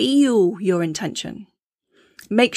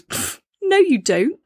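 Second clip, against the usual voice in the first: a scoffing, higher put-down; dismissive inner critic; 9 semitones higher, slower than usual. Retold or imitated. Inner critic scoffing at a self-love affirmation, dismissive. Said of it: "that inner voice inside goes".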